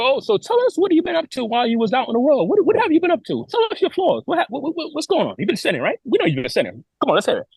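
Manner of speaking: mock prying elder. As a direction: imitate the elder digging for sins. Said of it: "So he said".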